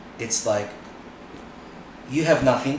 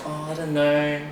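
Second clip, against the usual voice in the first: higher, louder, slowed fretful whine; mock nervous Aussie; 7 semitones higher, 6 dB louder than usual. Mocking Aussie dancers fretting before an event, in a high anxious whine. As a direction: play a timid dancer scared of judges. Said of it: "Here, it's like".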